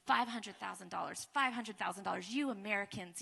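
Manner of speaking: low, quiet grumbling on the stairs. Retold or imitated. Grumbling about the sum while climbing the stairs, muttered low. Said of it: "I'm grumbling".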